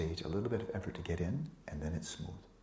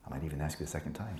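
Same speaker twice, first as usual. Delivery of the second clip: usual speaking voice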